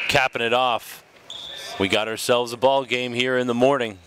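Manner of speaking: ordinary talk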